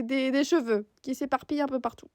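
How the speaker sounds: ordinary talk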